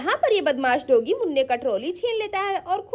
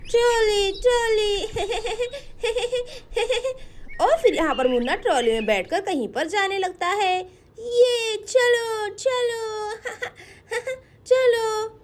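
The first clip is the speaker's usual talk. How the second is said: put-on little child's voice; 3 semitones higher than usual. Little Munna playing with trolley.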